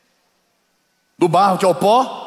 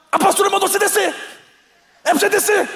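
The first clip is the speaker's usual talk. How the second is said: much higher, irritated, clipped order; angry disciple; faster than usual. Retold or imitated. Disciple fed up with the stairs, irritated high demand. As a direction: bark the order after climbing the stairs again. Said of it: "ficou com raiva".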